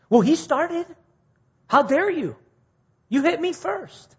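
put-on high, loud, childish protest; defensive outrage. Preacher voicing a man who was hit, high indignant protest. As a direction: play the hurt party crying foul. Acted retort to being hit.

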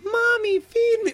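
high, eerie doll voice; creepy Chucky voice. Retold or imitated. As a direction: sound like a possessed doll. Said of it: "creepy Chucky voice".

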